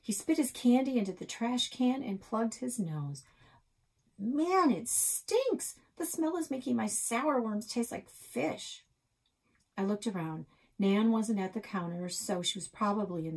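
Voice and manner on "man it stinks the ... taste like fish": put-on whiny boy. Boy complains about smell.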